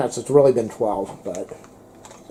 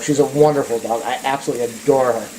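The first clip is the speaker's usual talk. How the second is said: normal talking voice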